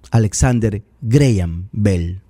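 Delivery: slow, careful Graham as "GREY-am"; correct English pronunciation. Shown as a demonstration. Commentator correcting the president, Graham slowly pronounced "GREY-am". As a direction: spell out Graham by ear, "GREY-am". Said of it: "la pronunciación es Graham".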